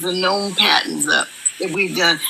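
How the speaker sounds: usual speaking voice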